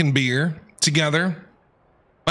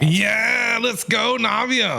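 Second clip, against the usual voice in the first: higher, shouted fan cheer; excited cheer; 6 semitones higher, much slower than usual. Real, not acted. Cheering as a favourite character joins.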